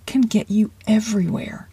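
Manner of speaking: hushed encouragement to the listener; whisper. Acted. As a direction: breathe it like a secret. Coach closes the point, whispered.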